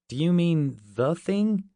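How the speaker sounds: "The" picked out before "Thing"; stress on The. A narrator's reading. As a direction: correct him by leaning on "The". Corrects the mistaken film title.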